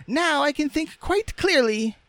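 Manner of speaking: very high, squeaky rag doll; Raggedy Ann's voice. A narrator's reading. Rag doll with her head mended, squeaky. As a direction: chirp it in a piping falsetto.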